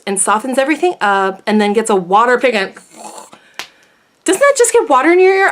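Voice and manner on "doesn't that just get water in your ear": high, loud, quick disbelieving squeak; mock incredulous question. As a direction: act out scoffing disbelief, fast and high. Mocking people who rinse ears.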